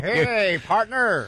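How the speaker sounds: put-on high old cowboy drawl; old west prospector. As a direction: greet like a desert old-timer. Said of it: "ghost town out west".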